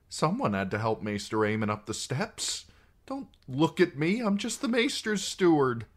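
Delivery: put-on high, rising anxious young man; fretting, pleading not to be noticed; nervous young steward. Sam's anxious inner plea.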